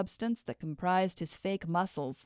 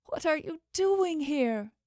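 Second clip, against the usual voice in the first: tearful; 10 semitones higher than usual. Acted. After starting to cry.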